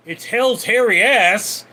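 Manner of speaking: a loud, gleeful exclamation; loud joking outburst. Real, not acted. Streamer loudly jokes to chat about Tiger King, loud gleeful exclamation. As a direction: belt it out as a crude joke.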